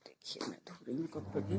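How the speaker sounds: real whisper so no one wakes; breathy hushed whisper. Real, not acted. Showing the washing machine at dawn, whispering. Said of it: "क्योंकि सब लोग सो रहे हैं".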